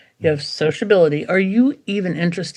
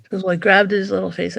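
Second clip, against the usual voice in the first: normal talking voice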